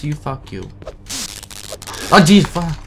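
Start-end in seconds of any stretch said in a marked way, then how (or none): 2.1-2.8 sudden loud scared yell; startled yell. Real, not acted. Gamer jump-scared by a monster, yelling. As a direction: jolt and yell it out.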